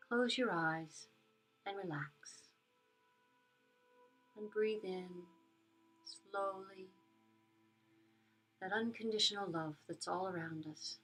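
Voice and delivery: soft spa whisper. Acted. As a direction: guide them gently into calm. Leads a metta meditation.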